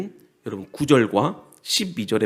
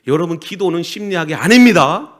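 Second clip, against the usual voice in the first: loud, raised-pitch pulpit delivery; forceful preaching; 7 semitones higher, 13 dB louder than usual. Real, not acted. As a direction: drive each point home loudly to the congregation. (힘주어 외치는 설교)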